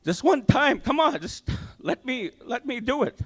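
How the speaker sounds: high, loud desperate cry for help; yelling to God. Retold or imitated. Driving home sick, he yells out to God to heal him, a high loud plea. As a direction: shout the plea up to the sky. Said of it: "I yelled out to God".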